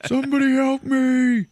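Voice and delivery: hammed-up wounded man's holler; yelling out. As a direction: imitate a shot man calling across a warehouse. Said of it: "starts yelling out".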